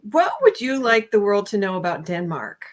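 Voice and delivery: normal talking voice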